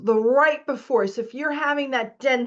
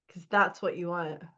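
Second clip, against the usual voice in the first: usual speaking voice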